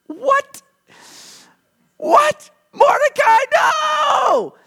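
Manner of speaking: shocked disbelief. Retold or imitated. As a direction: freak out as the honor goes to your enemy. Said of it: "He's like".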